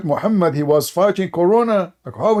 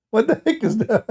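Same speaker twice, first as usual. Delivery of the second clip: laughing; 5 semitones higher than usual. Real, not acted. Mocking laugh at the idea.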